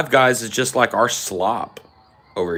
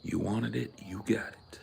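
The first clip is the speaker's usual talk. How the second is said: breathy whispered catchphrase; 15 dB quieter than usual. Pretend. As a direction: say it under your breath.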